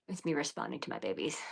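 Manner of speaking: ordinary talk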